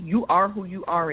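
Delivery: normal talking voice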